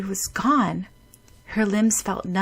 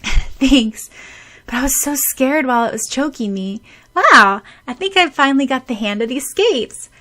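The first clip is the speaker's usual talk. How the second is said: put-on girl's voice; 6 semitones higher, 7 dB louder than usual. Sakura voiced in story.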